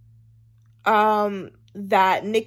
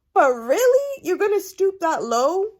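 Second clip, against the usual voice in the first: half-crying reproach, up high; dismayed scolding; 8 semitones higher than usual. Retold or imitated. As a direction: plead with her in dismay. Scolding Sophia, cry heard.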